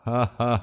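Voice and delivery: taunting villain's ha ha; menacing laugh. Acted. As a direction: laugh to terrify the killer. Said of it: "آخری کہہ کہہ لگا کے".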